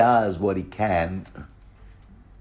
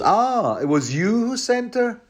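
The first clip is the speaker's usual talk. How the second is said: much higher, incredulous put-on voice; mock Jaime; 13 semitones higher than usual. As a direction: squeak out his disbelief as Jaime. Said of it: "So Jamie goes".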